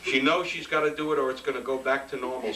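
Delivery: normal talking voice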